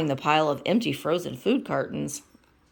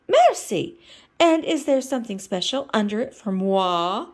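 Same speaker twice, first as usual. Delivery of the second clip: Miss Piggy voice; 5 semitones higher, 5 dB louder than usual. Acted.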